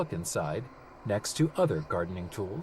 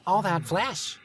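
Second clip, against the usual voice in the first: hungry gnome; 7 semitones higher, slower than usual. Acted. Gnome eyeing him hungrily.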